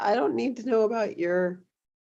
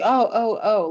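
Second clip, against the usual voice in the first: louder, raised oh oh oh; lightly voiced startled man; mock startled men; 4 semitones higher, 6 dB louder, slower than usual. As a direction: play the guy caught out, belatedly. Imitating men noticing late.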